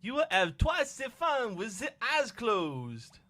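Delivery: put-on high, slow French-accented coaxing; high character voice. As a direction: promise slowly in high mock French. Voices French slime game character.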